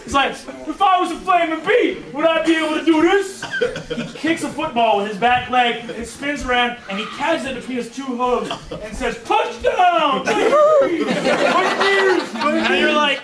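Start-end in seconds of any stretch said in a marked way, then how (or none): 0.7-3.4 higher-pitched boastful jock voice; hammed-up proof of team loyalty; showing off. Player proves he is a Flaming Bee, showing off. 9.2-12.4 high-pitched celebratory yell; touchdown cheer. Acted. Player celebrates catching the football, touchdown cheer.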